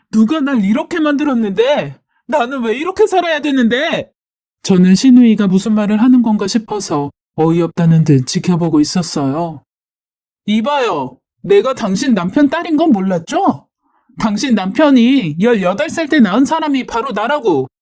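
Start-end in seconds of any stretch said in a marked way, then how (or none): 0.1-4.1 high, wailing protest; anguished outburst. Acted. Sister-in-law lashing out at her family, high anguished protest. 10.5-17.7 higher, taunting disclosure; defiant revelation. Acted. Sister-in-law revealing her parentage to the wife, defiant taunt.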